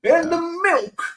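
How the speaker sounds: put-on high old man voice; squeaky old geezer.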